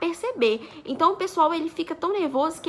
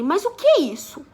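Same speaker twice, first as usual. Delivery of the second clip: higher, louder baffled question; retold astonishment; 4 semitones higher, 5 dB louder than usual. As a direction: imitate a taken-aback officer. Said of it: "um cara de espanto".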